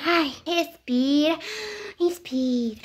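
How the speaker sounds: slow, low words between breaths; deep calming breaths. Acted. Nervous girl follows mother's advice, breathing deeply. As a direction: breathe slowly to steady yourself. Daughter breathes to calm herself.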